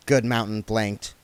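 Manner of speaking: ordinary talk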